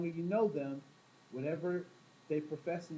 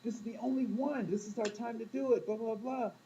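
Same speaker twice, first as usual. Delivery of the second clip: higher, pushy eager voice; eager brain; 6 semitones higher than usual. Pretend. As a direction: talk yourself into it, pitched up. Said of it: "your brain is like".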